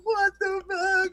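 high drawn disbelief; amazed. Retold or imitated. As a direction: stretch it out in awe. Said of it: "I'm like".

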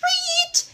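short piercing squeal, pitch far up; high boar squeal. A narrator's reading. The boar squealing before it charges, piercing squeal. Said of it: "The boar said".

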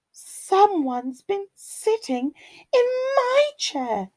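high, lilting mother bear voice; Mummy Bear. A narrator's reading. Mummy Bear finds her chair used, high lilting complaint. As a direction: pitch it high as a put-out mama bear. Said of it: "rumbled Mummy Bear".

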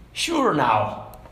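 a hint of an aged Irishman; Irish accent. Old man answering the curious bystander, light Irish accent. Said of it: "try the accent again".

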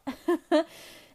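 short self-mocking laugh; embarrassed laugh. Real, not acted. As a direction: laugh at yourself, a bit embarrassed. Admits she is bad baker.

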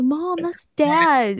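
high, slow, drawn-out mock wail; retold whine. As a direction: plead to mom, stretching each word. Retells own tearful plea to mother.

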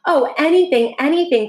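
excited cry. Acted. Little soul eagerly agrees to friend, excited cry. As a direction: burst out in joy. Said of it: "cried the little soul".

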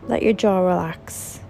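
calm, measured meditation guide with long pauses; soothing relaxation-exercise voice, phrase by phrase; guided meditation. Acted. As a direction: unhurriedly guide them through a body scan, leaving space to breathe. Leads a guided body scan meditation.

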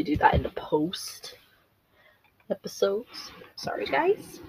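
breathy apologetic whisper to the viewers. Real, not acted. Whispering sorry to viewers for skipping parts. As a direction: drop to a whisper, like a guilty aside.

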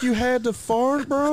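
put-on husband squealing at his wife for waking the baby; mock outrage. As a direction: act scandalised and disbelieving. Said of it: "I was like".

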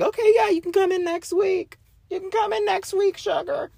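put-on high, sweet little old receptionist; sweet old lady calling you sugar. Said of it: "that was my attempt at an old lady voice".

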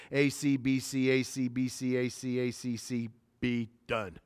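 low monotone rattle of letters; soft, careless run through the answers; offhand rattling-off. Retold or imitated. Retelling a boy guessing through a quiz, offhand monotone.

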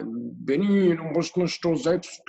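put-on thick Russian accent in German. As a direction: imitate a Russian healer's accent. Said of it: "mit so einem klassischen russischen Akzent".

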